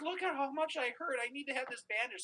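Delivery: high, mock-pleading kid voice; imitating distraught son. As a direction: whine for sympathy as a hurt child. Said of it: "he's falling to pieces".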